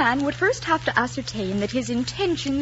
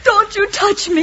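high, loud, drawn-out rebuff; distraught, slow warning cry; tearful anger; 9 semitones higher, 8 dB louder, slower than usual. Acted. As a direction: recoil from him through tears. Crying niece rejects uncle.

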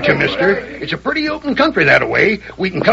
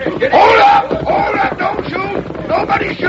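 high, urgent shout to stop the posse; 9 semitones higher, slower than usual. Acted. Sheriff shouting to the arriving posse to hold fire. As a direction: yell to hold everyone's fire. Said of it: "Harkness shouted".

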